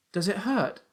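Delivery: put-on high boyish question; worried young son. A narrator's reading. Son asks injured father about pain, worried. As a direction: ask in a high child's voice.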